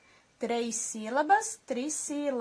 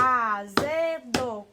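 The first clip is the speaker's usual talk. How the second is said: "Azedo" very slow and loud, in three beats; separando as sílabas; 5 dB louder, much slower than usual. Shown as a demonstration. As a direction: stretch "Azedo" out as a-ze-do, loudly. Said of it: "batemos palma aí". ("Azedo" forte e bem lento, sílaba por sílaba)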